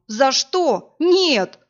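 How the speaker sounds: high loud protesting cry; despairing cry. A narrator's reading. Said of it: "вопль Ильмо".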